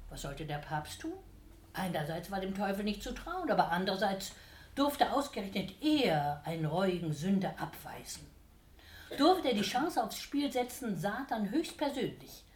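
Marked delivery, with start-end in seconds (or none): none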